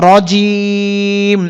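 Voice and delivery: রজিম drawn out long, ending on a held মিম; pausal form. Shown as a demonstration. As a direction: stretch রজিম slowly, stop on the m. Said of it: "উচ্চারণ হবে". (রজিম ধীরে টেনে, মিম সাকিন করে)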